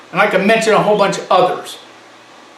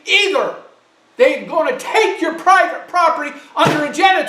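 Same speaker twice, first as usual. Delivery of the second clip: raised, higher-pitched angry pleading; angry outburst; 7 semitones higher than usual. Real, not acted. Radio host railing at his listeners, high and angry. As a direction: rail at the listeners to wake up. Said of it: "I'm not gonna apologize for getting angry and getting loud".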